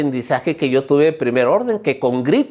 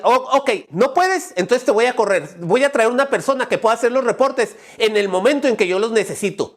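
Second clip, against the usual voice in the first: raised-pitch angry manager; threatening boss; 6 semitones higher than usual. Acted. Manager threatening an employee over a late report, angry. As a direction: threaten to fire them, voice pitched up. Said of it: "Tengo que gritarle, tengo que decirle, amenazarlo".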